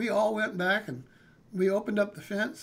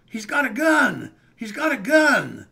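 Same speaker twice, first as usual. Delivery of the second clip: loud slow alarmed shout; panicked shout of a gun; retold yell; 3 semitones higher, 9 dB louder, slower than usual. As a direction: yell the warning across the yard. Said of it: "I hear one of the guys yell".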